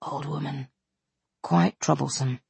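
a servant's hushed aside; hushed footman's murmur; low voice. A narrator's reading. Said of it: "said something to him in a low voice".

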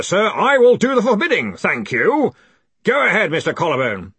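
booming, indignant master of the college; pompous outrage with hammered emphasis; sudden fury. A narrator's reading. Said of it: "in sudden fury".

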